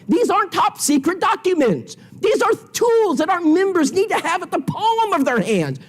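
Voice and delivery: high, urgent pulpit exhortation; rising sermon appeal; preaching at full force. Acted. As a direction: drive the point home to the congregation.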